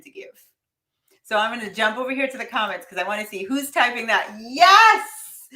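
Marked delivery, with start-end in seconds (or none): 4.4-5.5 a loud, high, real cheer; joyful shout of yes; excited yes. Real, not acted. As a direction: celebrate the comment out loud. Cheering a viewer's comment.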